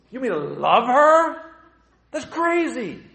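high, loud, slow outraged disbelief; mock-scandalized listener, voiced high; incredulous disbelief. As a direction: sound shocked at the very idea.

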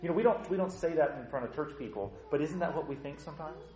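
normal talking voice

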